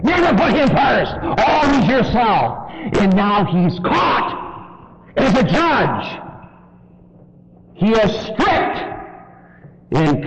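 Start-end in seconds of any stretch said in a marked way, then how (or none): 0.0-6.2 full-force pulpit preaching; fiery preaching. Acted. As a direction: preach it hard and fast, building.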